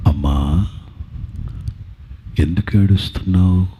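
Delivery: very deep, measured divine voice; solemn low-pitched question to a weeping woman; voice of Jesus. Acted. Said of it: "యేసు ప్రభు పునరుద్ధానుడైన తర్వాత మొట్టమొదట మాట్లాడిన మాట".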